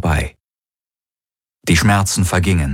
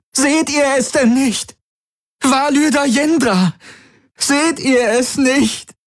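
much higher, frantic yelling, slowing at the end; girl struggling in the guard's grip, screaming desperately. A narrator's reading. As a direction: fight free and warn everyone. Kirai struggles, screaming accusations.

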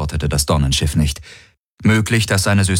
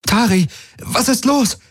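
high, alarmed call; urgent high-pitched question; calling over noise. A narrator's reading. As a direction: cry out over the din. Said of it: "rief sie über den Lärm".

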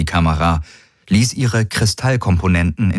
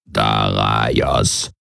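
much lower, slow croaked call of the name; croaking Kilfer call; 9 semitones lower than usual. A narrator's reading. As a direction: croak the name out like a toad creature. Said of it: "rief der Kilfer aus".

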